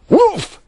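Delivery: high, loud, hopeful bark; hopeful questioning woof. A narrator's reading. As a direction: bark like a pleading dog. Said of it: "asked Brute, hopefully".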